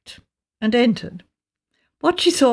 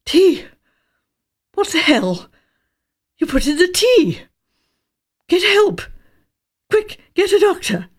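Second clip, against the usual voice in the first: jerky gasps; 9 semitones higher than usual. A narrator's reading. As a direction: force each word out between spasms. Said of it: "Words came out in jerky gasps".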